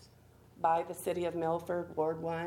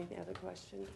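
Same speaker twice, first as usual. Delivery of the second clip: hoarse, worn-out voice from pollen; losing her voice; 8 dB quieter, faster than usual. Real, not acted. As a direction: speak through a failing voice. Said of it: "I'm losing my voice because of all this pollen".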